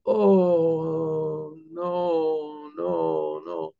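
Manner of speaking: wailed "no", dropping and fading; acted crying. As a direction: imitate a defendant breaking down in drawn-out sobs. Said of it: "he starts to cry".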